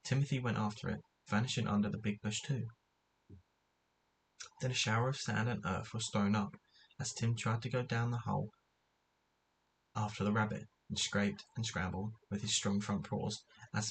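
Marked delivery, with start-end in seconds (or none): none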